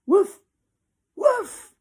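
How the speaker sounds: high, loud dog barks; barking. Acted. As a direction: bark like an angry dog. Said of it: "he barked".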